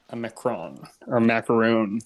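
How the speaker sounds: "macaron" ending French-style in -on, "macaroon" ending in -oon; two pronunciations. Shown as a demonstration. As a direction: set "macaron" against "macaroon" as rival pronunciations. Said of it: "depending on how you pronounce it".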